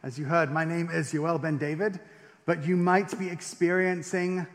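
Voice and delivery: usual speaking voice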